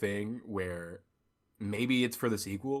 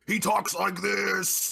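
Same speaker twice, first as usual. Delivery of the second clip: loud, high, slow villain voice; Gabriel; 10 semitones higher, 5 dB louder, slower than usual. Shown as a demonstration. Mocking how Gabriel talks in the movie, loud monster impression. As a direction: stretch it out in a booming mock monster voice. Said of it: "we should note that Gabriel".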